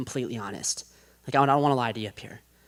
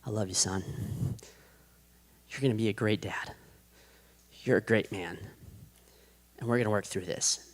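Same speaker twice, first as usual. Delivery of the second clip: soft, lower, gentle fatherly voice; father's tender reassurance; 4 semitones lower, 8 dB quieter than usual. Retold or imitated. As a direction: reassure a broken son quietly. Father comforting his son.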